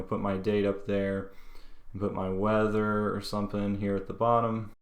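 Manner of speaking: ordinary talk, nothing marked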